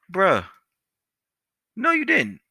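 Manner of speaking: high-pitched mock disbelief; acted-out incredulous comeback; incredulous retort. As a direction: draw back in high, indignant disbelief. Acted disbelief at being threatened.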